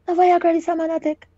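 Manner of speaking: as if the penny just dropped; shocked realisation. Retold or imitated. Realising it is Viagra.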